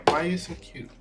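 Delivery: talking to a dog. Real, not acted. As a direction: coo at a puppy. Baby talk to dog.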